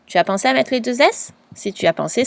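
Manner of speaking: ordinary talk, nothing marked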